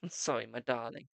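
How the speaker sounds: ordinary delivery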